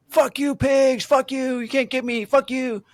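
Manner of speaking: high-pitched mock yell, taunting; imitated window yelling. Man taunts police from third-floor window, imitated yelling. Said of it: "he was yelling out of his window".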